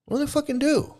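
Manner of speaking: very high, incredulous angry question; exasperated. Real, not acted. Ranting about what these preachers do, exasperated. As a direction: demand it with pitch shooting up.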